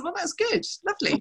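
high, sugary little "lovely"; a hint of forced sweetness; mock sweet politeness. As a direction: lightly send up a too-polite reply. Retold polite reply to Blue.

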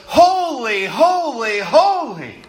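HOLY, HOLY, HOLY, each one a stretched, rising-falling peak; emphatic threefold repetition. Shown as a demonstration. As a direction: lean hard on every HOLY, three equal swells. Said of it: "you say it three times".

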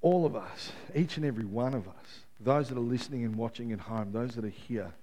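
soft, low altar-call voice over music; hushed closing prayer voice; quiet prayer ministry. Acted. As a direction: lead them gently into prayer. Prayer ministry, eyes closed.

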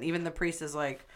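usual speaking voice

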